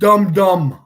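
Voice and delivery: loud, teasing "Dum dum"; a playground jeer, loud; mocking taunt. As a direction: call them dummies in a sing-song. Mocking the chat's reasoning.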